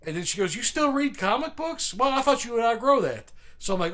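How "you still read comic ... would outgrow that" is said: high-pitched, teasing imitation of a woman; mock-chummy, pitched up; imitating chummy acquaintance. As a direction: tease like an over-familiar old friend. Retold woman's chummy catching up.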